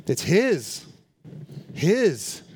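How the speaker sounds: his weighted and set apart on its own; his said slowly and with weight; stress on his. Shown as a demonstration. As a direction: lean on the word his, then repeat it alone. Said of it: "All the stress is there".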